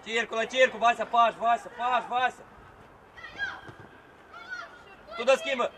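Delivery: loud repeated instructions; coaching shout. Real, not acted. Coach directing Vasea during play, loud shout. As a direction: call out orders across the field.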